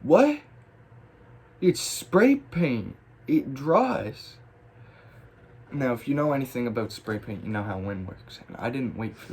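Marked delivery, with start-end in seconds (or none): 0.0-0.4 loud, very high rising yelp of disbelief; incredulous. Retold or imitated. Retold shock at ruined tag. 1.6-4.4 high-pitched, drawn-out disbelief; baffled protest. Retold or imitated. Retold disbelief at blown-away paint.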